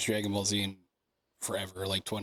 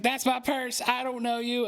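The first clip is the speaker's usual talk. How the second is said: Bobby Hill quote; 11 semitones higher than usual. Retold or imitated. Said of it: "And then he's like".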